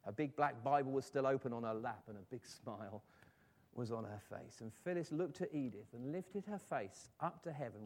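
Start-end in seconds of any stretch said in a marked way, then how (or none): none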